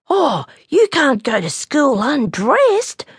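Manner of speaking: put-on high, drawn-out childish shock; scandalised little bear. A narrator's reading. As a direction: gasp it out, high and slow. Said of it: "Snubby looked very shocked".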